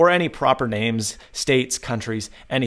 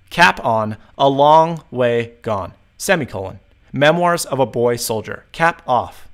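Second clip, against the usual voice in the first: slow, deliberate dictation; 4 dB louder, slower than usual. Shown as a demonstration. Tutor demonstrating dictation to phone, deliberate. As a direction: read each word clearly for the machine.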